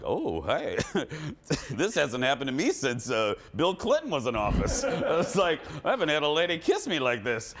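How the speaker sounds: flustered, laughing through it. Retold or imitated. Host kissed by a grandma, laughing and flustered. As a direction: giggle while getting kissed. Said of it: "I was just like".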